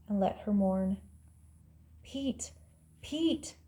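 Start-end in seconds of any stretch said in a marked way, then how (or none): 2.0-3.6 soft, high-pitched mock yell; hushed imitation of a far-carrying call. A narrator's reading. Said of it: "she yelled again".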